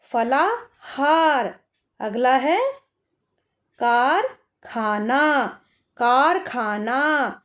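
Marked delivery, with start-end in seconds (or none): 0.1-1.5 फलाहार read slowly, each syllable clear; slow reading aloud. Shown as a demonstration. Teacher reading a word to children, slow and clear. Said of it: "तो कैसे पढ़ेंगे इसको". 3.8-7.4 फलाहार read slowly, each syllable clear; slow reading aloud. Shown as a demonstration. Teacher reading a word to children, slow and clear. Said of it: "का के बाद भी आ की साउंड".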